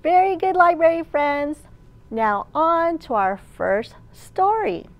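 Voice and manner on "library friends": ordinary delivery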